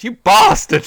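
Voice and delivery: shrieking high and loud; hammed-up furious shriek; mock scream. Livilla screams at Sejanus, hammed-up, high and loud. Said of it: "Livilla's like".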